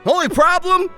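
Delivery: usual speaking voice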